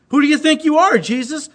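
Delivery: high, loud imitation of an indignant critic; mock angry put-down. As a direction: challenge him as if offended, hammed-up. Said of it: "when they're mad and want to put me down".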